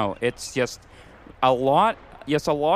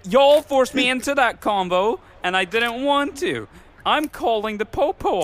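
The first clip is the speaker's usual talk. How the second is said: louder, higher complaint; loud protest; 7 semitones higher, 4 dB louder than usual. Real, not acted. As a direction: protest it indignantly. Protesting friends' accusation.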